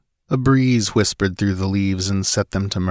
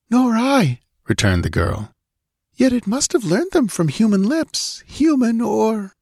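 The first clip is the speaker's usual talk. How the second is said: put-on much higher girl's voice, slowing at the end; uneasy young woman; 14 semitones higher than usual. A narrator's reading. Olivia answers Conan, uneasy about the parrot. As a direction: let the dread creep in as she trails off.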